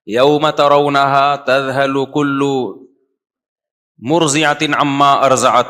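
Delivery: slow, drawn-out Arabic recitation; measured scriptural recitation; Quran recitation. Real, not acted. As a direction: recite the verse slowly, holding the long vowels.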